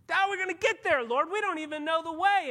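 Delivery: loud, high, frantic questioning; a worried follower cries out; panicked disciples. Acted.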